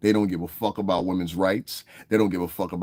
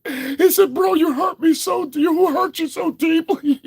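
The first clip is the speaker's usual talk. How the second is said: mocking high, whimpering fake-hurt voice; whiny oversensitive commenter; 5 dB louder than usual. Mocking a sensitive commenter in a high, whiny voice. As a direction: ham up a wounded crybaby, way up high. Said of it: "That's how you fucking sound".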